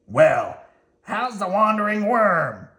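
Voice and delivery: loud gruff-ish Oscar, lightly voiced; Oscar greeting. Oscar greets Slimy home, in a loud teasing voice. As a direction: tease him as he returns.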